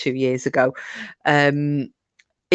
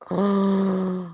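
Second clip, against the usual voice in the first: shocked gasp; 4 semitones higher, 3 dB quieter than usual. Retold or imitated. Shocked at the mirror reveal.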